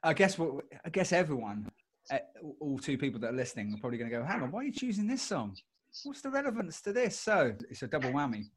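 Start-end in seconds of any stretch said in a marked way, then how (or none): 4.2-7.2 higher, quizzical listener; puzzled listener voice. Pretend. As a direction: mimic a confused listener asking.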